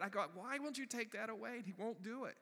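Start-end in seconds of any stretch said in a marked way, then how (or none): 0.3-1.6 high-pitched, hammed-up complaint; retold exasperated plea. Preacher asking God about his unwanted tears, exasperated. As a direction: protest upward in mock frustration.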